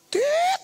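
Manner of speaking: high-pitched imitated bleep; censor beep. Preacher bleeping out a worldly person's swearing, high censor beep. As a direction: squeak out a sustained censor beep.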